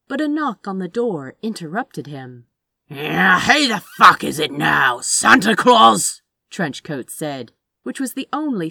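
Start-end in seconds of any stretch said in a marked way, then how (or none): 2.9-6.2 loud, rough, exasperated yell; angry shout. Acted. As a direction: bark it at the door. Rude shout at knocking door.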